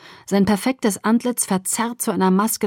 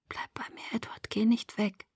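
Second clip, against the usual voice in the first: whisper; 2 semitones higher, 12 dB quieter than usual. A narrator's reading. Fading girl begging Edward to stay.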